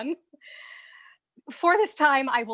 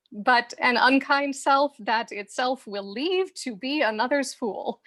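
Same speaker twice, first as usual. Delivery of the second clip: ordinary talk, nothing marked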